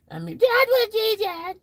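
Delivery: high mock squeak; squeaky voice. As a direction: squeak it like a cartoon. Squeaky joking repeat.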